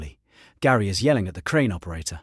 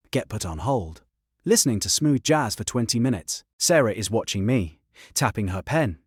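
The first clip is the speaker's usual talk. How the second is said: ordinary delivery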